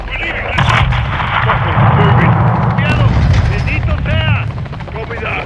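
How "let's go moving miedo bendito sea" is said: breathless combat yelling; shouting. Acted. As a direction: yell while running for cover. Escaping under fire.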